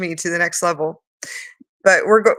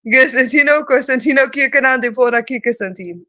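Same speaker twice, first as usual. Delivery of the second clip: light language; 4 semitones higher than usual. Acted. Speaker channeling her higher self, calling out. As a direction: proclaim the syllables like a call.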